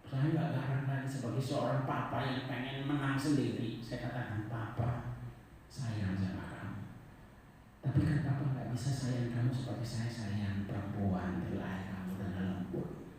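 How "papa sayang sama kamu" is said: soft, tender words to a son; softly reassuring father; gentle. Retold or imitated. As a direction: speak softly, like calming your boy. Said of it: "Saya ngomong dengan lembut sekali".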